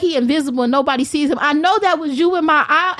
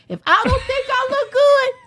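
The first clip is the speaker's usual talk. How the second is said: real laugh breaking into a shout, higher; 9 semitones higher than usual. Real, not acted. Laughing while boasting.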